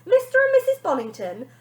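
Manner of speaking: high, loud contempt; nanny calling parents weak; scolding. Acted. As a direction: scold them.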